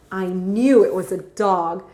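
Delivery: plain reading voice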